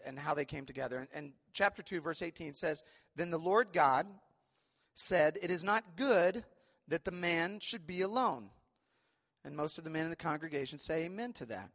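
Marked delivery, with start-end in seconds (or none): 3.2-8.5 slow, louder solemn Bible reading; scripture reading. Acted. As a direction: lift the verse out like a proclamation. Reads scripture aloud.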